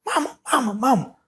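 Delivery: put-on high crying child's call for mama; a little girl wailing for her mother; sister hollering. Sister in the van crying out for mama, high and tearful. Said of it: "my sister was hollering".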